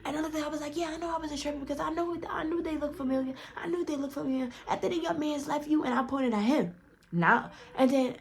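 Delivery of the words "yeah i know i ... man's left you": high, hushed, rapid rush of words; retold eager realization; excited recognition. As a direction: rush it out, pitched up, in vindication.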